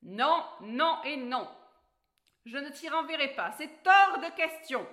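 the grumpy dwarf loses his temper and shouts no; furious dwarf growl. Acted. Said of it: "grogna le nain, furieux".

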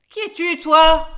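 high, loud dwarf's shout; startled yell. A narrator's reading. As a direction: yell it out in alarm. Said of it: "hurla le nain".

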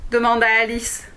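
usual speaking voice

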